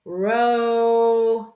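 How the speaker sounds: ROW held long and loud, D left off; sounding out for blending. Shown as a demonstration. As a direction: stretch out ROW, stopping before the D. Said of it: "So let's blend it".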